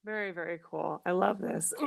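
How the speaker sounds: the voice briefly gives out; voice dropping out. Real, not acted. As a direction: voice cutting out, very quiet. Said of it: "Lost my voice for a second".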